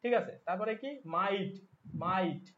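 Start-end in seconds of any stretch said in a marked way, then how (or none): 1.2-2.4 মাইট drawn out as "ma-it"; ending shown. Shown as a demonstration. Teacher shows the shared rhyme ending, মাইট as "ma-it". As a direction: say মাইট slowly, exposing the "it". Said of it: "এই যে ইট".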